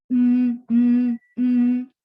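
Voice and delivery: loud sustained nasal hum; humming. Shown as a demonstration. Teacher demonstrates a voiced consonant, loud steady hum. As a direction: hold each "mm" loud and level.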